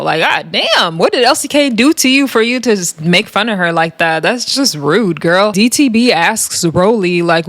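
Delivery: normal talking voice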